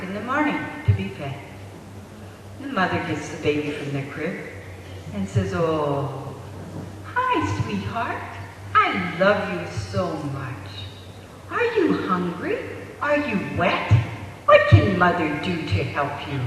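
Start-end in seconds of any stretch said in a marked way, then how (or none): 5.5-16.4 sweet parentese. Acted. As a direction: coo sweetly, lilting up on the questions. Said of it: "you talk to it so sweetly".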